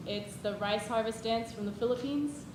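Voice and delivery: ordinary delivery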